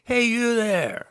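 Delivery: high, slow hammed-up American accent yell; playful American accent, ordering him about; American accent, calling out behind a camera; mock American accent. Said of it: "in her version of an American accent".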